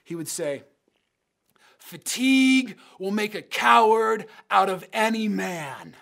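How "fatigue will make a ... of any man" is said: loud, raised sideline yell; coach's yell. Retold or imitated. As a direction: call it out like a coach driving exhausted runners. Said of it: "he would yell this phrase at us".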